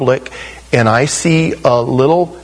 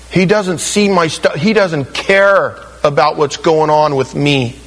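mock-whiny complaint to God, raised in pitch; whiny prayer complaint; 6 semitones higher than usual. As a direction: play a sulky believer griping at God. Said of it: "prayed to God".

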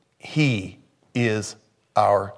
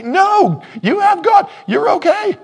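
much higher, loud shouted rebuttal; preaching shout; 8 dB louder than usual. Real, not acted. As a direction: shout it down from the pulpit.

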